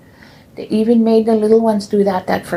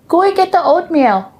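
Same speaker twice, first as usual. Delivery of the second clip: stern nun order; 9 semitones higher than usual. Retold or imitated.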